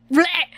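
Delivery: high, loud mock-disgusted bleh. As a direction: blurt out a revolted noise. Disgusted at praising herself.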